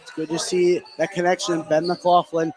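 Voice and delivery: usual speaking voice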